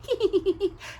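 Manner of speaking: high witch giggle. A narrator's reading. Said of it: "Sarah giggled".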